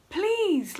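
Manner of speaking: high, drawn-out please; stretched imploring cry; tearful pleading. Retold or imitated. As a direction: imitate a desperate plea, falling away. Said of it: "crying my eyes out all night".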